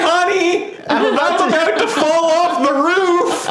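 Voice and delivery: laughing. Pretend. Mock warning call while laughing.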